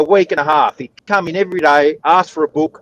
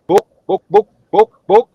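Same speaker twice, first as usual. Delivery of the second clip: chicken clucking; 5 semitones higher, 6 dB louder than usual. Retold or imitated.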